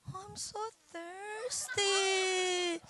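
high, soft, very slow mock whine; wheedling wife. Wife begs sleepy husband for a drink, mock whine. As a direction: stretch out a pitiful high plea.

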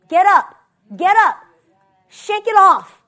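loud, high, punched calls; rousing command. Retold or imitated. Preacher voicing God to the congregation, rousing command. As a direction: burst out to rouse them. God's call, rising to shouts.

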